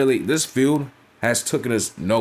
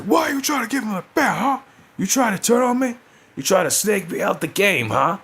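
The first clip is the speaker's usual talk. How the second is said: mock confrontation in a much higher put-on voice; accusing a traitor, voice pushed high; Karrion Kross going off; 12 semitones higher than usual. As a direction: pitch it way up and call her out like a jilted partner. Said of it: "Karrion Kross just going off on her".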